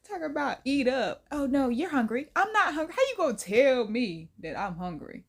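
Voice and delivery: normal talking voice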